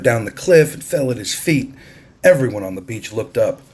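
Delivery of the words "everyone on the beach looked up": usual speaking voice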